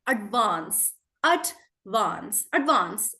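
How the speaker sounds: "advance" drawn out with a broad A as in "father"; broad British A in "advance". Shown as a demonstration. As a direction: say "advance" slowly the British way, long "ah". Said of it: "British is?".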